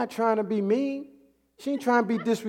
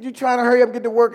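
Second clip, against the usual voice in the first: ordinary talk, nothing marked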